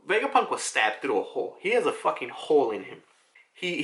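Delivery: ordinary talk, nothing marked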